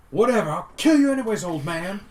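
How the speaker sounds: high, loud, fast cocky sneer; cocky taunt. Acted. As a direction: sneer it at the old man. Brutalizer taunting dwarf.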